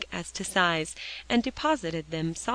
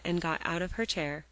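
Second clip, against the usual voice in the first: plain reading voice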